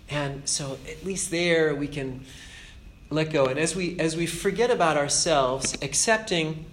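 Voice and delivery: ordinary talk, nothing marked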